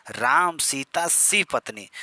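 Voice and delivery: slow, सी drawn out and falling; stress on सी. Shown as a demonstration. Teacher slowly showing emphasis, सी stressed. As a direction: dwell on सी. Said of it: "तो ये बल देने का काम करेगा".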